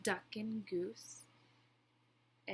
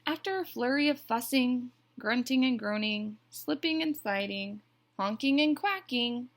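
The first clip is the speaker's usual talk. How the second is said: put-on loud, animated listing for children; story narration; 4 semitones higher, 12 dB louder than usual. A narrator's reading. Teacher reads the egg squabble, animated narration. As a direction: read each noisy action with relish.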